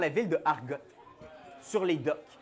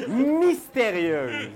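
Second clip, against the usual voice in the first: mysterious; 5 dB louder, much slower than usual. A narrator's reading. GM narrates mysterious woman.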